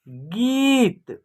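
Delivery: गीत very slowly, ee held long; long vowel. Shown as a demonstration. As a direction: stretch the ī in गीत. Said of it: "खींच करके होगा".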